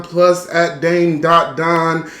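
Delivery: ordinary talk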